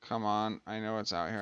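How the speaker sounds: usual speaking voice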